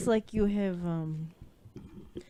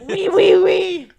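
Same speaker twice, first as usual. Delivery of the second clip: loud, higher mock French oui; French accent; 10 semitones higher, 13 dB louder, slower than usual. Asked to argue in a French accent, she starts loudly. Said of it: "French accent".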